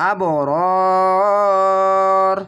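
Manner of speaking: আবরর drawn very long with a bounced b; long chanted আবরর with a qalqala jolt; qalqala bounce. Shown as a demonstration. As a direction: Quranic qalqala recitation. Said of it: "ধাক্কা মেরে কলকলা হবে".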